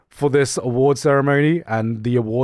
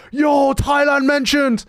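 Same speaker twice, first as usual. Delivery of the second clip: hammed-up hype, pitched way up; hyped chatter; 11 semitones higher than usual. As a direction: cheer it like an overexcited chat spammer. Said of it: "I'm that chatter to be like".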